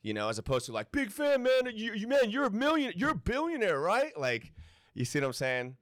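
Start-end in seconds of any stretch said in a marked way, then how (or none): 0.9-4.2 high, loud starstruck fan gushing. Retold or imitated. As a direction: gush like a starstruck fan meeting a hero.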